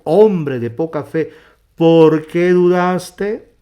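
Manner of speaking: high, drawn-out challenge; loud rebuke. Acted. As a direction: rebuke him ringingly, stretching each word. Voicing Jesus rebuking Peter.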